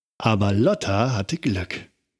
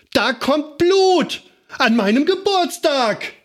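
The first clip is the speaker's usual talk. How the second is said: screaming wail; slower than usual. A narrator's reading. Lotta bleeding on her birthday screams to the street. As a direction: wail it for the whole street to hear. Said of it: "schrie Lotta laut".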